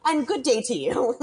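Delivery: bow out with exaggerated politeness; mock-genteel farewell. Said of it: "He's like".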